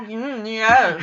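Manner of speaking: hammy German villain voice; slow, sneering German accent; movie villain German accent. Pretend. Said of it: "I'm gonna do my German accent".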